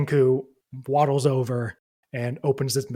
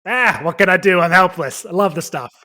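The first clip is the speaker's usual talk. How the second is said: hammed-up rat; 5 semitones higher, 7 dB louder than usual. Rat player hams up cheese craving.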